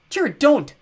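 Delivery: high, loud yell. Acted. Simon yells at his brother not to surrender, loud. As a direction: cry out to stop him. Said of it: "Simon yelled".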